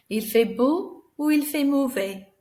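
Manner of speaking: animated choice question. Shown as a demonstration. Teacher miming the weather for pupils, lively and high. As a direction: play it up with the mime. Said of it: "once you've got mime".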